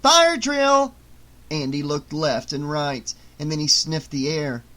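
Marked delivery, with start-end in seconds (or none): 0.0-0.9 loud, high-pitched call down a corridor; yell. A narrator's reading. Teenagers yelling to the kids in the hall, loud shout. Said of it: "looked at him and yelled".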